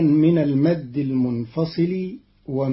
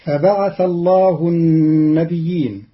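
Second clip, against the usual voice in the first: "فبعث الله النبيين" chanted louder, tartil; recited verse; 4 dB louder than usual. Shown as a demonstration. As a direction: recite it as in prayer. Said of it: "قرأ قالون كلمة النبيين". (تلاوة مرتلة بصوت أعلى)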